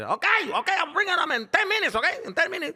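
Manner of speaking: high, loud hurried mock dad; voicing dad. Son voicing dad agreeing to bring him, hammed-up hurry. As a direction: promise it fast like a caught father.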